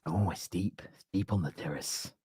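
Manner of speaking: soft, low, awed murmur; mock-profound hush. Streamer admiring his own life lesson, mock-profound hush. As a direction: drop right down as if it's profound.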